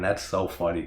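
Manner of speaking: ordinary delivery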